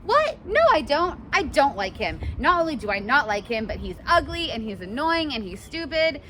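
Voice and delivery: high, loud flustered denial; girl caught out by her teasing friends, protesting; defensive denial. Pretend. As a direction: deny it too hard, all in a fluster. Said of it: "And she's like".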